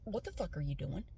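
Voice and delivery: quoting him low and quiet; a quiet, menacing demand; hushed angry boyfriend; low hushed anger. Retold or imitated. Angry boyfriend confronts her.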